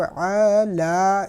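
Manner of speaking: لا dragged out long, the wrong way; wrong stretched lam. Shown as a demonstration. Teacher showing students how not to read the lam. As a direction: overstretch the لا to show the mistake. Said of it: "اس طرح نہیں کیا".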